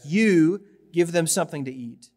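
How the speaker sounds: you hit firmly at the start; stress on you. Shown as a demonstration. Jesus tells the disciples, you stressed. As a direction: punch you, then even. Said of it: "you" is emphatic".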